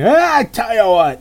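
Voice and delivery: mock yelled reply; Tony. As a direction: imitate a big guy hollering back hello. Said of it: "Hey, how you doing, Tony?".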